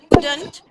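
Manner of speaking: Couldn't spoken loud and crisp as the model word. Shown as a demonstration. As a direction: announce the word couldn't loudly. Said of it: "तो could not को हम couldn't कहते हैं".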